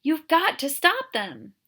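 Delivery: loud, slow, high urging. Acted. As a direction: insist he act now. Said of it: "urged Catherine".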